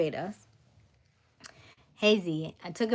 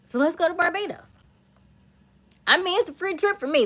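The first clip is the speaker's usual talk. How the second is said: higher, louder glee; excited; 9 semitones higher, 7 dB louder than usual. Real, not acted. Accepting a free trip to Barbados, excited. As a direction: cheer it on.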